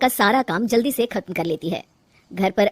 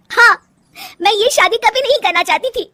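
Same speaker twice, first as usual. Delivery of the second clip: very high, loud confession; distressed, heated admission; upset outburst; 12 semitones higher, 7 dB louder than usual. Acted. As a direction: blurt it out at the top of the voice. Wife blurts out unwanted marriage.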